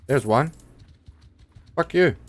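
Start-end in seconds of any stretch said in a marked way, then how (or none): 1.8-2.2 high, loud yell; shouted insult. Real, not acted. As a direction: shout it at the enemy. Yells at enemy.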